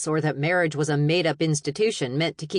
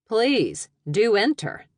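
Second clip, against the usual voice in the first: loud, high, mock-gracious invitation; exaggerated sarcastic politeness; 7 semitones higher, 5 dB louder, slower than usual. A narrator's reading. Daughter to mom who barged into her room, sarcastic. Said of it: "I said sarcastically".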